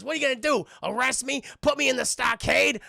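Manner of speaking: loud, high, acted dare; mock defiance; defiant taunt. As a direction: taunt the police, cocky and loud. Character taunts arriving police.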